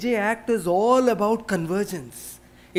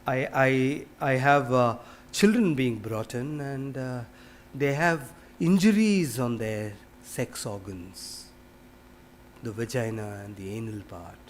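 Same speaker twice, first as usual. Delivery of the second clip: retold tearful pleading; 7 semitones lower, 5 dB quieter than usual. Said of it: "And then she broke down".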